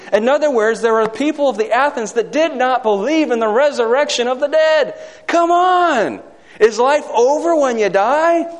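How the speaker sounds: rising fervor, near shouting at the peak; full-force preaching. Acted. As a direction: preach it out, building to a cry.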